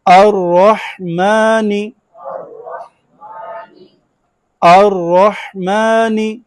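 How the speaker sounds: drawn-out chanted Quran recitation; slow recitation. Shown as a demonstration. Teacher leads class in slow chanted recitation. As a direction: chant each word slowly, leaning on और. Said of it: "मिला के पढ़ेंगे".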